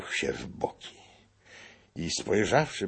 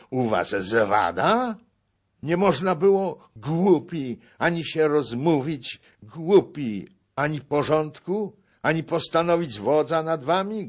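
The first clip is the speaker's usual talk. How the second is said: loud, high-pitched tirade; exasperated, raised-voice rebuke; angry scolding; 10 semitones higher, 7 dB louder than usual. A narrator's reading. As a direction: berate the crowd, punching each phrase. Old man berates the assembled fools.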